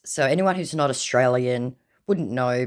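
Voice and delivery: ordinary talk, nothing marked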